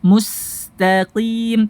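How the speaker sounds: slow MUSTAQIM with a light TA; thin ta. Shown as a demonstration. Teacher leading practice, slow recitation with thin ta. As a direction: stretch MUSTAQIM, keeping TA thin. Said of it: "tak tipis".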